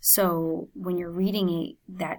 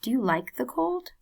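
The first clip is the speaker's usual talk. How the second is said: helping man; 6 semitones higher than usual. Acted. Airport helper greets the narrator, lightly voiced friendly man. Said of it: "he says".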